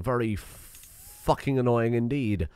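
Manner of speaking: ordinary talk, nothing marked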